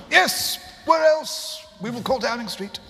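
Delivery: high, loud, slow insistent boss; Steve's eager voice. Retold or imitated. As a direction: drag it out, loud and bossy. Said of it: "he said".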